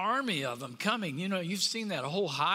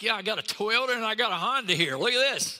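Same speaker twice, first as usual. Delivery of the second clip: higher and louder, showing off; bragging strongman; 5 semitones higher, 7 dB louder, faster than usual. Pretend. Ant-strong human showing off a Toyota and a Honda, bragging. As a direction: boast like you're lifting two cars. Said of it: "It's like".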